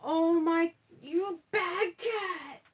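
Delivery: high, slow pet-scolding voice; scolding the cat. Real, not acted. To her cat Punky, slow high scolding voice. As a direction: draw out the scolding like talking to a pet.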